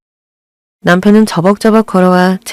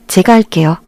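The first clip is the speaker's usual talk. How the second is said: plain reading voice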